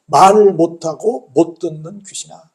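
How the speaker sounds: slow, loud, drawn-out address to the spirit; stern rebuke. Retold or imitated. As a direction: imitate Jesus rebuking a spirit, word by word. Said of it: "귀신을 이렇게 말씀하십니다". (천천히 힘주어 귀신을 꾸짖듯이)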